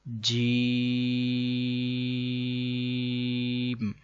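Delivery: جیم with the long vowel held steadily for seconds; elongated letter. Shown as a demonstration. Teacher starts reading the letters to students, جیم held long. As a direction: stretch the ee of جیم on one level note. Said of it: "تین second لمبا کریں گے".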